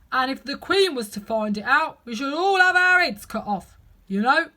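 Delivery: loud gardener voice with odd timbre; low voice. Acted. Two explains to Alice in a put-on gardener voice. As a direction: explain nervously to the young miss. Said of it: "Two began in a low voice".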